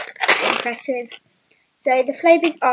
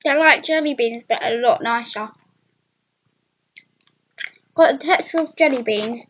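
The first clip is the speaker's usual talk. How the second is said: speaking around chewed sweets; chewing candy while talking. Real, not acted. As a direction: hand over full mouth. Seen 4: Candy goes in at -7; hand covers chewing mouth while talking.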